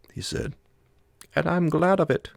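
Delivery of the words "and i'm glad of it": much higher, louder foreign companion voice; the Swede. A narrator's reading. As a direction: give the Swede a high, loud, strained voice.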